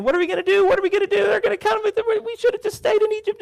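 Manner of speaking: very high, loud panicked wail; frantic crowd whine; panicking Israelites. Retold or imitated. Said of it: "they're all saying".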